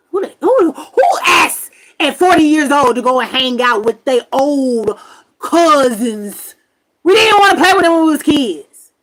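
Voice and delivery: loud, high mock yell at uncle; retold angry shout. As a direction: yell it in disbelief. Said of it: "I said".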